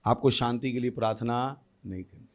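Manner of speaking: normal talking voice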